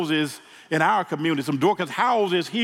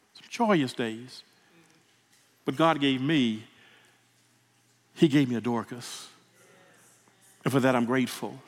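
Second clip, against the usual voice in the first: softer, with long halting pauses; fighting back tears, thankful; real emotion, quiet and halting; emotional gratitude; 3 semitones lower, 5 dB quieter than usual. Real, not acted. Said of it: "Excuse me, I'm getting emotional".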